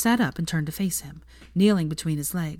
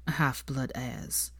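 very slow and much softer; slow bitter quote; 4 semitones lower, 8 dB quieter, much slower than usual. A narrator's reading. Draco quotes his mother's cruel words, slowly and softly. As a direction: drag out the hurtful words quietly.

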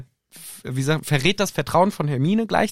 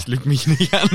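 laughing misquote. Acted. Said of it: "Er sagt".

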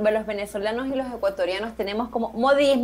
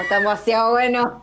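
gleeful shout; 4 dB louder than usual. Retold or imitated. Enthusing over the cheesecake.

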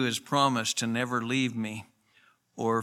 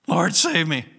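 higher, louder cry of Lord, save me; desperate call for rescue, raised voice; urgent cry for help; 6 semitones higher, 6 dB louder than usual. Retold or imitated. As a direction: cry out like a sinking man.

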